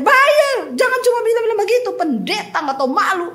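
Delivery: debt collector yelling at the pastor to pay; angry debt collector. Retold or imitated. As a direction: snap at her to pay up. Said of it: "Kamu ini bertobat loh".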